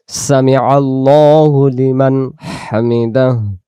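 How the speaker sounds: Arabic সামি আল্লাহু লিমান হামিদাহ drawn out slowly, দাহ ending clear; slow recited Arabic. Shown as a demonstration. Teacher demonstrating prayer recitation, slow and drawn out. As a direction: chant the prayer phrase slowly with full endings. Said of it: "এমন আওয়াজ আসবে".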